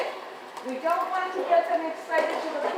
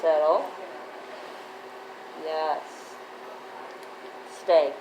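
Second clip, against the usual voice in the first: talking to a dog; 10 semitones lower, 5 dB quieter, slower than usual. Real, not acted. Trainer calming the dog.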